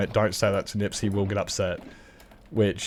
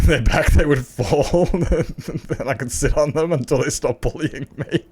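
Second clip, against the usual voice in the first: higher, louder, words broken by laughter; laughing; 5 semitones higher, 6 dB louder, slower than usual. Real, not acted. Streamer recalling sitting on his bullies, laughing. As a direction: tell it while cracking up.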